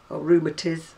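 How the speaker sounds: rheumatiz said as ROO-ma-tiz, clipped end for rheumatism; mum's rheumatiz. Retold or imitated. Vlogger recalls her mum's word for rheumatism, old northern rheumatiz. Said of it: "as my mum used to call it".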